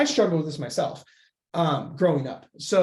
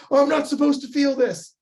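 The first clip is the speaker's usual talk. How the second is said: much higher, louder hammed-up fretting; mock panicked voice; 10 semitones higher, 6 dB louder than usual. Coach mocking someone avoiding their feelings, in a mock panic. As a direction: squeal it like a flustered prude. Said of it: "you're like".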